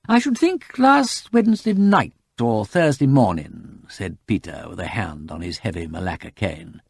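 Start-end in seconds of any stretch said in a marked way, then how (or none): 0.1-3.8 high, slow, measured reply; deliberate gentleman. A narrator's reading. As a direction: answer an angry man slowly and pointedly. Peter braves the enraged farmer.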